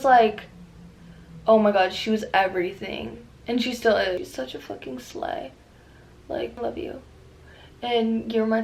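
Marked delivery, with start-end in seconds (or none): 4.2-7.0 whisper. Real, not acted. Gushing over childhood idol.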